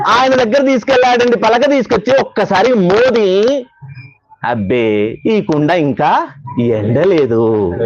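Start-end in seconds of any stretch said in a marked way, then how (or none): 4.4-7.7 much lower, very slow, dismissive verdict; disapproving potter. Acted. Potter rejecting Namdev's pot before the saints, low and slow. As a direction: drag it out low like a stern potter judging a pot.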